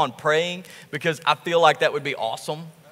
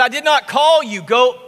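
fed-up priest, higher and louder; annoyed priest; 6 semitones higher, 4 dB louder than usual. Retold or imitated. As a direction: snap at the boy to go back to sleep. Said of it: "He said".

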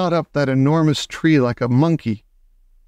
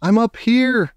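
calling down from high in a tree; boy shouting down; 10 semitones higher than usual. A narrator's reading. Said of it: "I shouted back".

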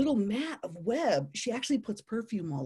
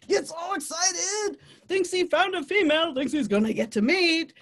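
excited storytelling; 9 semitones higher, 5 dB louder than usual. A narrator's reading. Telling visitors of next male's hopes, high mock excitement. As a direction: build giddy excitement phrase by phrase.

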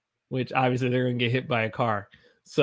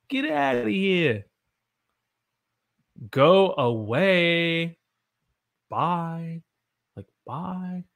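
mock dismissive; 5 semitones higher, much slower than usual. Shown as a demonstration. Teacher acting out phrase.

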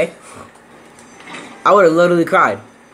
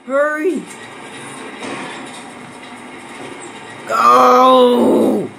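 panicked yelling; 9 semitones higher, much slower than usual. Real, not acted. Gamer fleeing zombies, yelling in panic. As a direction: yell in real panic as they close in.

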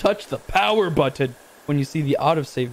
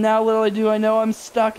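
normal talking voice

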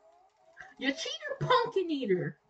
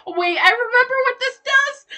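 excited; 9 semitones higher, 13 dB louder than usual. Real, not acted. Excited discovery in game.

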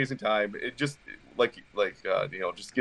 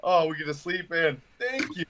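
higher-pitched retold delight; so pumped; 7 semitones higher than usual. Hunter retelling his joy at getting to sleep in, pumped. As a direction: act out pumped relief, voice rising high. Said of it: "I was so pumped".

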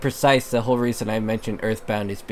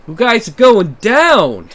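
loud higher taunt; trash talk; 10 semitones higher, 10 dB louder, slower than usual. Acted. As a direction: shout it at the rivals. Taunting the competitors.

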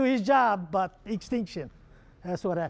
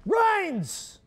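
high shouted answer; yelled out; 9 semitones higher than usual. Retold or imitated. Student yelling out his answer in class, high shout. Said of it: "the guy yelled out".

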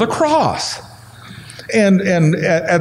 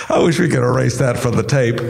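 laughing; 4 semitones lower, faster than usual. Real, not acted. Laughing after voice crack.

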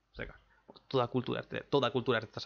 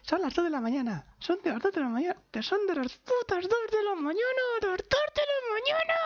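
cada vez más agudo hasta el chillido; euforia contenida; 3 dB louder than usual. Real, not acted. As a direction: sube de voz emocionada a falsete desbocado. Rabia contenida a las dos. (emoción contenida que acaba en chillido agudísimo)

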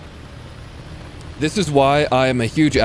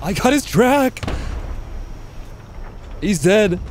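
excited; 10 semitones higher than usual. Real, not acted. Tracking an enemy tank in game.

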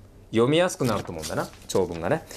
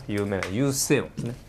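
ordinary delivery

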